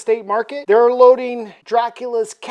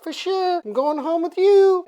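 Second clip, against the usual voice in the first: very high female character voice; eager young woman; 9 semitones higher than usual. Acted. Ellie accepting Dracula's invitation, high eager voice. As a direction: agree keenly, pitched way up. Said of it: "She's like".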